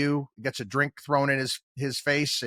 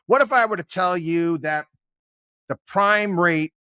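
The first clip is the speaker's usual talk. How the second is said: ordinary delivery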